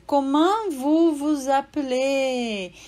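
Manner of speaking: Comment vous vous appelez slowly, comment with nasal an; slow French question. Shown as a demonstration. Teacher to student: slow model Comment vous vous appelez. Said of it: "Olha só a frase".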